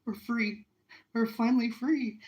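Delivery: high, emotional retold cry of relief; tearful relief. Telling of being finally free, tearful relief. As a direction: relive the bawling, pitch lifted. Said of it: "I'm bawling my head off".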